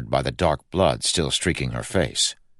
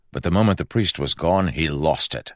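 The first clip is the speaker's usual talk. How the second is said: ordinary delivery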